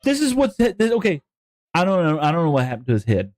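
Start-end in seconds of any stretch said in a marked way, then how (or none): none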